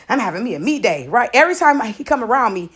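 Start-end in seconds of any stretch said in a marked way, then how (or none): 0.1-1.1 a lower, quick imitation of dad; dad's voice lightly imitated. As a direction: toss it off like a laid-back dad. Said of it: "This how me and my dad roll".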